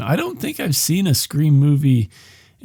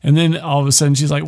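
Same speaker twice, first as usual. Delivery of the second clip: usual speaking voice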